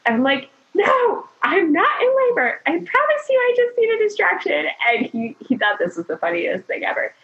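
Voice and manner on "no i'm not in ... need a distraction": very high, insistent protest; hammed-up, high-pitched touchy retort; exasperated denial. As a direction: squeal it in mock indignation. Acted repeat denial to laughing husband.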